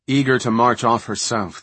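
plain reading voice

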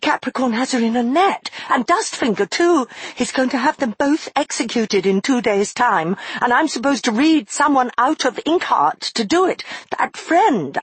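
put-on high, urgent girl voice; anxious girl. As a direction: rush the worry out.

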